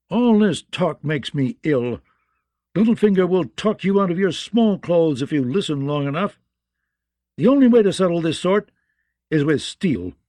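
scornful hothead knight; impatient swordsman spoiling for a fight. Acted. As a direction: push hard, then land firmly on the threat.

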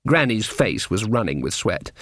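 usual speaking voice

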